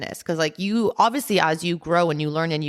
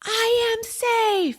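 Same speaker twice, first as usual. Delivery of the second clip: very high, drawn-out cry; slow, stretched, full-throated release; high wailing scream; much slower than usual. Retold or imitated. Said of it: "she's, like, screaming".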